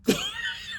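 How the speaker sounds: high squeaky gasp of a yawn held in; stifled yawn noise. Retold or imitated. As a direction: swallow the yawn into a tiny squeak. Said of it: "you went".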